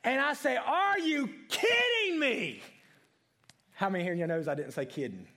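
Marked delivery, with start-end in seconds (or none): 0.6-2.6 high, loud, slow exasperated yell; a worker sees spilled sugar and yells in disbelief. Retold or imitated. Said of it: "all the redneck from my mama's side of the family comes out of me".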